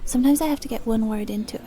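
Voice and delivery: usual speaking voice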